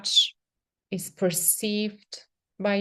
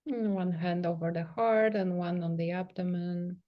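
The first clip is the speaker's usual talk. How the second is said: soft, unhurried meditation voice with long pauses; guided meditation; 4 dB quieter than usual. Real, not acted. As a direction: gently guide them into sensing the body, leaving space between phrases. Leads a guided self-touch meditation.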